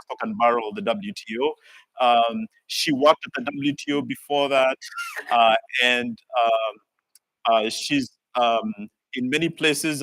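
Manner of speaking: normal talking voice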